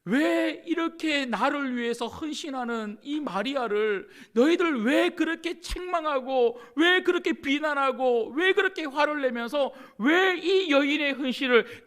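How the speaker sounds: impassioned pulpit cry; fiery preaching. Acted. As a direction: drive each 왜 home to the congregation. Preaching at full force.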